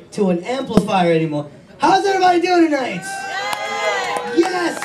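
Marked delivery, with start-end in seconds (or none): 1.8-4.8 high, fast laughing shout; high giddy hello, laughing; excited crowd greeting. Real, not acted. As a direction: whip the crowd up.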